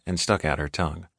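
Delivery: plain reading voice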